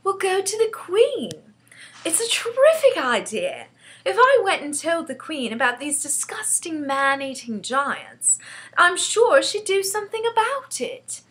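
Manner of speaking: put-on high-pitched, excited girl; earnest child sure of her idea; eager young Sophie. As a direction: pitch the plan with rising enthusiasm. Sophie proposes her plan.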